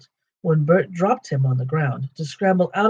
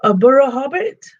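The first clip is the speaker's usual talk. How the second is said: much higher, louder startled question; three trolls startled at a hobbit; 10 semitones higher, 6 dB louder than usual. Acted. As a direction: trolls baffled by a strange word. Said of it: "said they, a bit startled".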